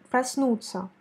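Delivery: проснуться with the first O said as a short A, prasnut'sya; unstressed O reduced to A in проснуться. Shown as a demonstration. Teacher demonstrates to student, first O said as A. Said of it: "il faut la prononcer comme A".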